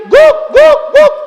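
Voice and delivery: loud, high-pitched triple woof; imitated barking, high and punchy; dog bark shouted. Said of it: "dia teriak lagi".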